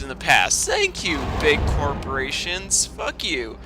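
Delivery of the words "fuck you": ordinary talk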